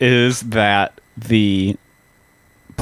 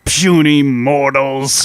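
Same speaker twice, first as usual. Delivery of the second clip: villain voice; slower than usual. Retold or imitated. Joins villain speech.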